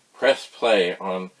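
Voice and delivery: usual speaking voice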